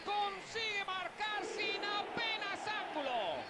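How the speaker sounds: high, drawn-out commentator shout; excited commentary. Acted. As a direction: call it like a replay highlight. Replay of goal, high shout.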